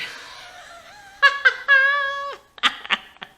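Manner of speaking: high, loud cackling laugh; drunken cackle. A narrator's reading. Howie gloating at his bandmates, loud high cackle. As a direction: cackle like a rowdy rocker. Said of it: "Howie cackles as he cracks another beer".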